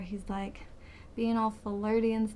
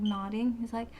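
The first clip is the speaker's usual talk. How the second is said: usual speaking voice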